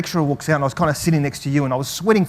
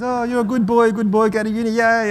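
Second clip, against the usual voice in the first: higher, sweet doting mum voice; mum's voice; 6 semitones higher than usual. Retold or imitated. Greek mum praising her son in a higher voice. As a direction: coo it like a proud Greek mum. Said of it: "She goes".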